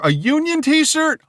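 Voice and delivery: high, slow, rising disbelief; incredulous question. Real, not acted. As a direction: ask it in excited disbelief, pitch soaring. Reacting with disbelief to merchandise.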